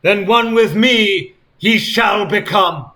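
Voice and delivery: high, loud archaic crowing voice; theatrical mock-archaic crow; crowing Sonic. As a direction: crow it like a gloating villain. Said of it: "Sonic crowed".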